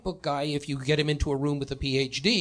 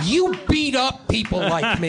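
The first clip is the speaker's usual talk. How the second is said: higher, louder mock indignation; inner accusation; 7 semitones higher, 6 dB louder than usual. Nerd silently accuses a former bully, higher, louder mock indignation. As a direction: hurl the old grudge back at him.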